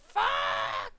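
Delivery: very high, loud shouted "Fuck!"; the big angry version he denies doing; full shout. Shown as a demonstration. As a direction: bellow "Fuck!" all out. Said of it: "f-, frustration".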